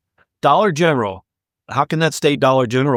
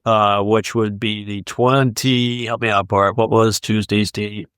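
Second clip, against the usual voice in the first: ordinary talk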